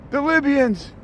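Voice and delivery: high alarmed cry; panicked movie quote. Retold or imitated. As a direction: shriek it like Doc spotting them. Back to the Future quote.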